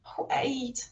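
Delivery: breathy whisper under her breath; hurt mum whispering to her child. A narrator's reading. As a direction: barely breathe the words. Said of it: "Mum was whispering under her breath".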